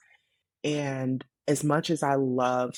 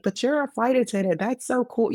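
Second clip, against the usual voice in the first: high, gushing mock enthusiasm; quick, high-pitched gushing; imitating impressed people; 10 semitones higher, 4 dB louder than usual. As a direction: mimic an impressed admirer, pitched way up. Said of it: "people would be like".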